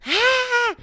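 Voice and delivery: high piercing mock scream; a sudden high yelp of panic; imitated drunk shriek. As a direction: shriek like someone's being stabbed. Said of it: "And she was screaming".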